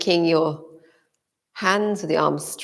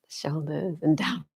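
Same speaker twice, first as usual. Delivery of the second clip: soft whispered cue; whispering; 6 dB quieter than usual. Real, not acted. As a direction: breathe the words out. Whispered cue during stretch.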